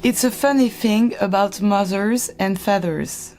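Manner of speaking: slow, careful English with a French accent. Real, not acted. Reading Matilda aloud despite embarrassment, French accent. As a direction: read a story slowly in French-accented English. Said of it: "je suis la seule avec mon accent".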